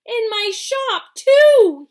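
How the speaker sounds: high, loud, slow yell. A narrator's reading. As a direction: yell it out with pride. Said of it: "he yelled".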